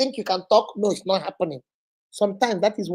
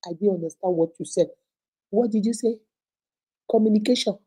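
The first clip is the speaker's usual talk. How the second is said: ordinary talk, nothing marked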